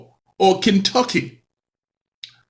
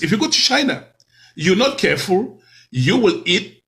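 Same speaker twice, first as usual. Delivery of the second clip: ordinary talk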